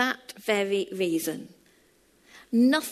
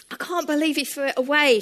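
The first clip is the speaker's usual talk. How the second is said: upset; 6 semitones higher, 4 dB louder, faster than usual. Retold or imitated. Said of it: "when she'd stopped crying".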